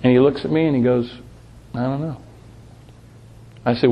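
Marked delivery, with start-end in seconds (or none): 1.7-2.2 imitating a boy's soft answer; softly voiced little boy; quiet child's reply. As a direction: say it small and soft, like an unsure child. Voicing seven-year-old boy's unsure answer.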